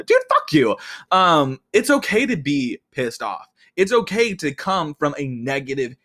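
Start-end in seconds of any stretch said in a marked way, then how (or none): 0.0-0.8 louder, sharp dismissal; defiant curse. Real, not acted. Vlogger snapping back at people who say calm down, defiant. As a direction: tell them off bluntly. Answering the advice-givers.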